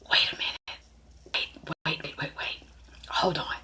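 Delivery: hushed, halting whisper of disbelief; shocked whisper. Retold or imitated. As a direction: imitate a stunned listener whispering to stop her. Shocked women react to gossip.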